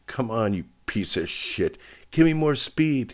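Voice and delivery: effortful demand rising in pitch and volume; grunted urging. A narrator's reading. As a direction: grunt angrily at a failing machine. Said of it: "he grunted".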